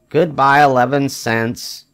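usual speaking voice

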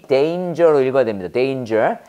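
"danger" with a long A, DAYN-jer; correct danger. Shown as a demonstration. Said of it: "A니까 A".

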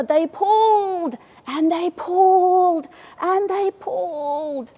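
high, slow, straining heave; slow effortful pulling chant. A narrator's reading. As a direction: heave each word like pulling hard.

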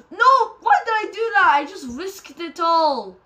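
high, loud cry of disbelief; loud, high yelp drawing out toward the end; exasperated outburst. Real, not acted. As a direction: yell at your own blunder. Regrets a risky game move.

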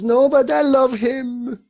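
put-on high, slow, loud little girl pleading; little girl begs Jesus to keep her teddy bear, high and pleading. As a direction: play a small girl clinging to her toy. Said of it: "And she was".

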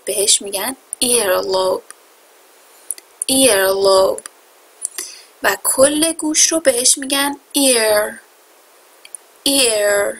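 ordinary talk, nothing marked

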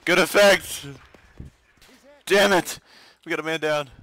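loud, high urgent callout; man down shout. Real, not acted. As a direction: shout the casualty call. Firefight, man down.

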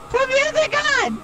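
high loud demand; shouting. Real, not acted. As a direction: shout it over the noise. Shouting request mid chase.

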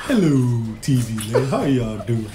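lightly voiced old TV show host; Ralph Kramden. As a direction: greet the TV audience, old sitcom style. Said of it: "Like Ralph Kramden".

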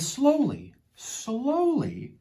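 storyteller drawing out the word "slowly"; drawn-out slowly. A narrator's reading. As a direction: stretch each "slowly", swooping up then down. Narrator acts out the slow walk.

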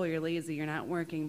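normal talking voice

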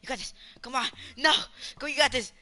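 loud, high self-hype; hype cheering. Real, not acted. Gamer cheering himself through a clutch, loud hype. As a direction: pump yourself up in the final moment.

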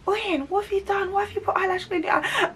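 high, incredulous scolding, lightly voiced; a hint of an exasperated receptionist; imitating the clinic woman. Clinic woman asking Oyin what she did, mock exasperation.

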